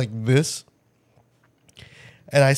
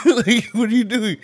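laughing disbelief; 7 semitones higher, 5 dB louder than usual. Retold or imitated. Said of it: "I'm like".

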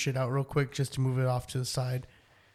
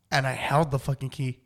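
normal talking voice

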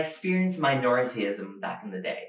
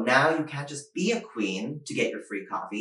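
ordinary talk, nothing marked

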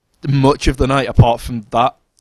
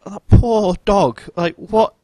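mock pity; 7 semitones higher, 5 dB louder than usual. Narrator reacting to her lonely hint, higher mock pity. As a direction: pity the dog, pitched up in disbelief. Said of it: "I was like".

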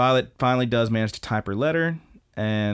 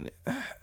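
soft put-on sigh of exasperation; exasperated sigh; 12 dB quieter than usual. [name] reacting to his mother's question, mock exasperated sigh. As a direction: let out a small imitated sigh. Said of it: "[name] looks kinda like".

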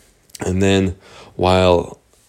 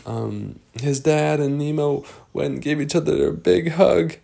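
plain reading voice

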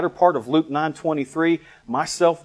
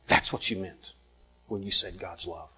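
low, soft dawning understanding; subdued, reverent murmur; hushed realization; 6 semitones lower, 9 dB quieter than usual. Retold or imitated. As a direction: let it sink in quietly. Voicing her quiet realization.

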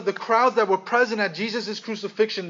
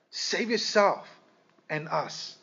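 ordinary delivery

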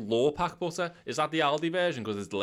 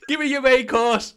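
much higher and louder, barking the demand in jest; mock yelled demand; 11 semitones higher, 6 dB louder than usual. Yelling at his mate to give his main course, mock shout. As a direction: shout it like a hammed-up bully.

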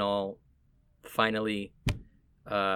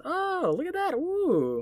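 much higher mock-delighted voice; changed inflection; slower than usual. Mimicking strangers gushing over her Puerto Rican roots, much higher. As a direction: pitch way up, gushing like an impressed stranger. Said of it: "inflection changes".

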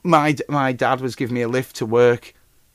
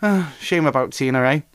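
normal talking voice

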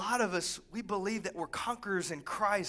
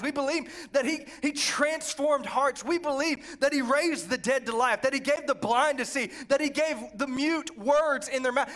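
building preacher cadence, rising higher as it goes; fired-up preaching; 5 semitones higher, 4 dB louder than usual. Real, not acted. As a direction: preach the list with mounting excitement. Preacher building through list of beliefs.

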